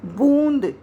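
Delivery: बूंद with a long, stressed oo; बूंद, loud long vowel. Shown as a demonstration. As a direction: lean on the oo of बूंद. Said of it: "ऊ पर जोर दिया जा रहा है".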